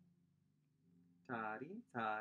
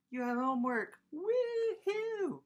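mock cheer; 14 semitones higher, 6 dB louder than usual. Mock celebration of homework.

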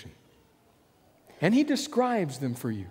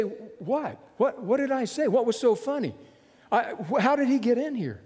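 flustered, stammering higher questions; taken aback; 6 semitones higher than usual. Pretend. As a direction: sputter it out, caught off guard. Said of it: "You're taken aback and you say".

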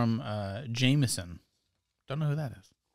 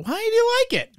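high mock voice; 4 dB louder, faster than usual. Reads the question as a mock voice.

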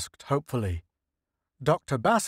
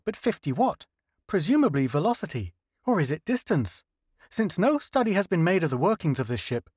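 higher, earnest explaining scientist; lightly voiced eager linguist, pitched up; 6 semitones higher than usual. Linguist explains the alien dials to the crew, higher earnest voice.

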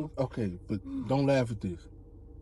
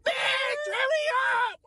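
high, yelled hurry up; mock shout; slower than usual. Driver yelling at him to hurry, high mock shout. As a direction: yell it like an impatient driver. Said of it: "y'all hung like".

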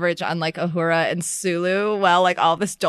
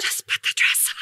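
hushed mock whisper; half-laughing whispered command; 5 dB quieter than usual. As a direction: whisper the order under your breath. Acted quote, whispered aside.

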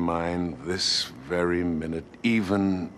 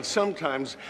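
ordinary delivery

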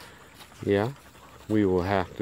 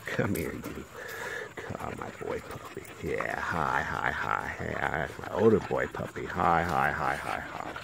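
cooing at puppy; 5 semitones lower, 6 dB quieter than usual. Real, not acted. Owner cooing softly to the boy puppy, tender. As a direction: hush and coo to the pup.

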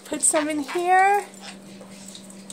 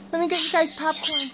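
usual speaking voice

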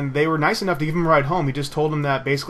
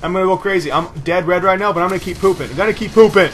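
raised, loud, worked-up gamer talk; hyped ranting; 4 semitones higher, 7 dB louder than usual. Real, not acted. Gamer nearly dead but going on, hyped and loud. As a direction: build to a loud, high finish.

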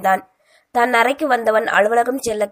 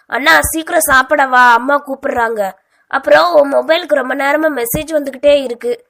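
loud, raised call through a closed door; 4 semitones higher, 6 dB louder than usual. Acted. Sister calls brother in the bathroom, loud calling out. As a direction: call him out to breakfast.

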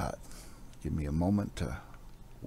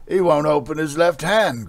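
louder, higher warrior's growl, straining; 9 semitones higher, 11 dB louder than usual. Acted. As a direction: bark it out while straining on the hand. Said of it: "growled another".

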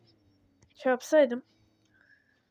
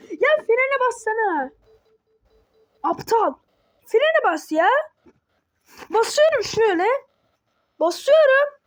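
high-pitched, frustrated complaining; agitated, voice jumping high; exasperated protest; 7 semitones higher than usual. Real, not acted. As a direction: argue heatedly that the brake won't work. Arguing over brake not responding.

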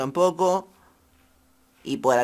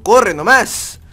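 louder, higher call to run; urgent shout; 5 semitones higher, 8 dB louder, much slower than usual. Pretend. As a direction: urge a player to flee. Huye en juego de terror. (grito urgente de huida)

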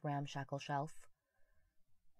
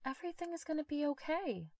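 much higher, eerie comforting voice; voice like mine; 9 semitones higher than usual. Acted. Ghost son comforts his mother in her own voice, higher. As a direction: soothe her from far away. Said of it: "his voice sounded like mine".

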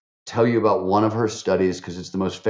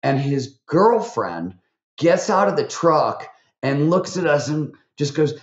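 normal talking voice

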